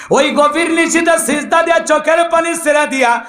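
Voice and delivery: high, sung-out sermon delivery; melodic preaching. Acted. Preacher urging the faithful to weep before Allah, melodic preaching. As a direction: preach it in a ringing chant.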